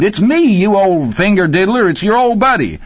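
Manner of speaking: high, loud, excited hollering; almost scream it to an old buddy; near scream. A narrator's reading. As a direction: exuberant high-pitched yelling. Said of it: "Rocky fairly screamed".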